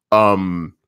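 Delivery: ordinary talk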